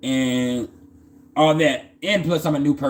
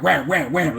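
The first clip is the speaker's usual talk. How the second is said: punchy, evenly spaced whams; imitated card slaps; 3 semitones higher than usual. Storyteller mimics cards being laid out, rhythmic slapping effect. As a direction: land each wham like a card dealt.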